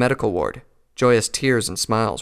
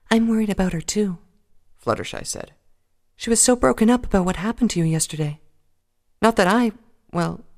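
high, gentle worried mare; timid pony voice; 11 semitones higher than usual. Acted. Fluttershy voiced by narrator.

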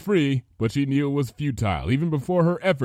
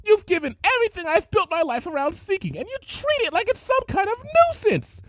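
high, loud raging tirade; furious rant; 5 dB louder than usual. Acted. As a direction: let the jealousy boil over. Rarity ranting in rage.

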